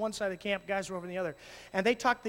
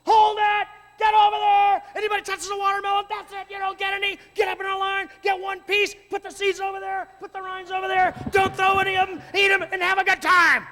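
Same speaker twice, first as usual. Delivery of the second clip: high, loud bossy yelling; mock drill sergeant counselors shouting rules; screaming camp adults; 9 semitones higher, 8 dB louder than usual. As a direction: bark orders at a mob of kids. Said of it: "screaming at the top of their lungs".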